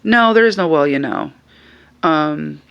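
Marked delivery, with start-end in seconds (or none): 0.0-1.3 sharp. Retold or imitated. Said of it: "And she's like".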